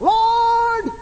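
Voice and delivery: high, long-held cry of "Lord". Acted. Mother with vexed daughter pleads to the Lord, crying out. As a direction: wail it out on one held note. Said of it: "crying out".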